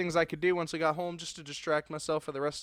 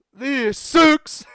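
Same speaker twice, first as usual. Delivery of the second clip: high, hammed-up moan of frustration; loud acted complaint; 9 semitones higher, 11 dB louder than usual. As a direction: cry out in exasperation. Trapped character complains.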